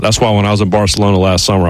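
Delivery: ordinary talk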